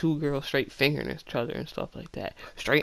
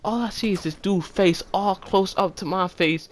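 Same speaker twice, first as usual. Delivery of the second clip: normal talking voice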